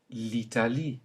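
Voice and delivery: l'Italie with clear French i sounds. Shown as a demonstration. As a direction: keep each i crisp. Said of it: "simply pronounced I".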